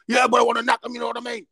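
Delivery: high-pitched, agitated student protest; protesting kid. Retold or imitated. Hard kid to teacher about hitting someone, high protest. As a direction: argue back as the lad, pitch well up. Acting the kid arguing back.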